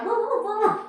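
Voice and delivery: high mock dog barks, roo roo; imitating a bark. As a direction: yip like a little dog, retelling the bark. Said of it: "I can't believe I just barked".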